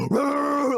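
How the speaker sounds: gasp, then high growly bark; rabid dog bark. Retold or imitated. Imitating the bark given to whoever is at the door, rabid dog bark. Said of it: "barked like a rabid dog".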